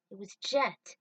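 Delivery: ordinary delivery, nothing marked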